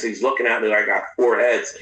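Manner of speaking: ordinary talk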